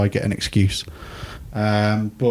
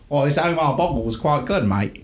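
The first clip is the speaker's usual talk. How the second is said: put-on a half-hearted Australian accent, trailing off; mock Australian accent; 4 semitones higher than usual. Quoting the Australian YouTuber's video, weak Australian accent. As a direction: lightly impersonate an Aussie lad. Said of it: "That's not a good Aussie accent".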